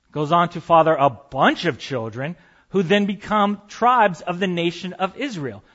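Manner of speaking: normal talking voice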